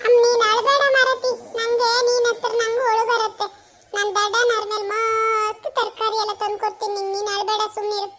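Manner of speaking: put-on high, loud child's voice, near tears; a little kid begging mom to stop crying; small child voice. Child consoling his weeping mother, high and teary.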